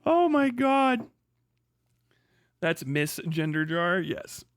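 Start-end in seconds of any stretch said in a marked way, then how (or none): none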